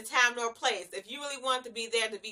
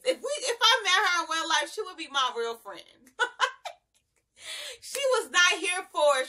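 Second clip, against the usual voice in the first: ordinary talk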